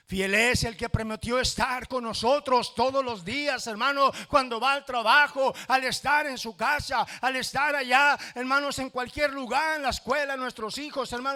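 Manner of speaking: loud, high, near-shouted preaching; preaching at full force. Acted. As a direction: cry it out to the congregation. (predicación a todo pulmón, casi gritando)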